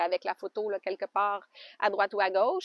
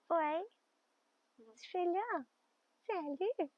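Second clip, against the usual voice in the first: cooing to a pet; 9 semitones higher, 8 dB quieter, slower than usual. Real, not acted. Cooing softly at her alpaca in the pen.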